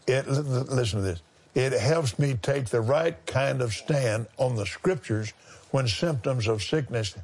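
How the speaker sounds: ordinary talk, nothing marked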